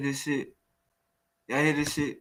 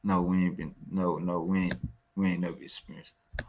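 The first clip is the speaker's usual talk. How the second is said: lower, softer mocking voice; a mild mock of the guest's denial; mocking quote; 7 semitones lower, 6 dB quieter, faster than usual. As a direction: parrot him with scorn. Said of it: "homie that would be like".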